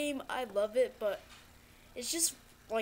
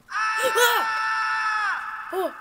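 sudden high terrified scream; scared scream; 11 semitones higher, 9 dB louder than usual. Real, not acted. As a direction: shriek at the jump scare. Jump scare in horror game.

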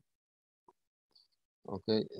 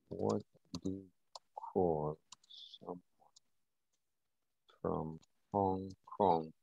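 slow, each word set apart by long pauses; 7 dB quieter than usual. Shown as a demonstration. Repeating the question to the chat, slow and word by word. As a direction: spell the question out one word at a time, slowly. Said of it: "repeats the question word by word".

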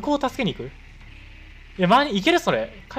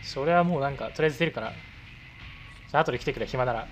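usual speaking voice

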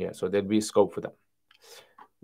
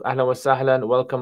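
normal talking voice

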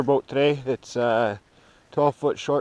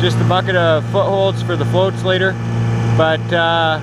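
loud, higher voice over engine noise; talking over noise; 4 semitones higher, 10 dB louder than usual. Real, not acted. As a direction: keep projecting over the motor. Talking over boat motor outdoors.

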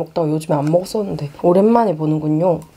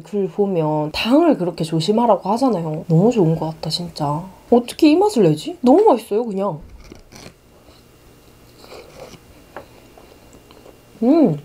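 talking between bites; while chewing. Real, not acted. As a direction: mouth partly full of bread. Seen 4: Holds bitten chocolate bun, lips closed, chewing between phrases.

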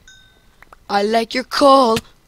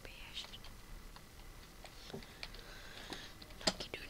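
voiceless whisper; whispered aside; 32 dB quieter, much faster than usual. Real, not acted. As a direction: barely breathe it out. Whispered outro.

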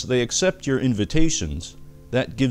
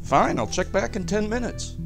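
higher-pitched, insistent salesman losing patience; exasperated pleading; 6 semitones higher than usual. Acted. Doctor Torpor pressing a client for a reading, exasperated. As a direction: push the reluctant client, pitch jumping up.

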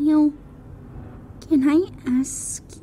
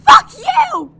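much higher, louder scream; yell; 10 dB louder than usual. Acted. Sadie defying the creature with a scream. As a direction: scream it in defiance. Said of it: "Sadie yelled".

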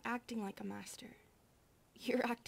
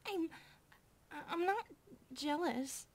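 flustered denial; 6 semitones higher than usual. Acted. Older maid denying jealousy to her teasing sister, flustered. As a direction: deny it too fast, caught out.

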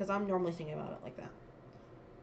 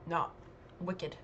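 ordinary talk

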